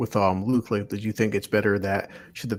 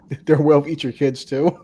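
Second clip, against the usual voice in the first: laughing; 3 semitones higher, 7 dB louder than usual. Real, not acted. Laughing question.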